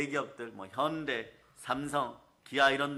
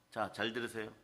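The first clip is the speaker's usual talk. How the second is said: normal talking voice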